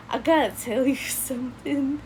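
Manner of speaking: can't keep the laugh out. Real, not acted. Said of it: "I'm laughing now because".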